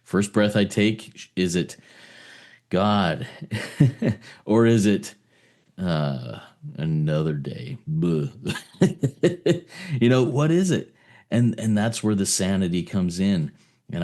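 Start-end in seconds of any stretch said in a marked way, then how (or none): 3.3-4.1 short laugh. Real, not acted. Laughing at his own joke. 8.8-9.9 bright burst of laughter; high laugh. Real, not acted. Guest laughing at his own morning joke.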